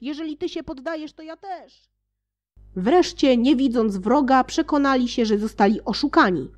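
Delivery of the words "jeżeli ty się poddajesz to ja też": higher, softer, fast repeat; fading echo. Acted. Another companion echoing, softer and fading away. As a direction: let it trail off like a distant voice. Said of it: "wołając jeden przez drugiego".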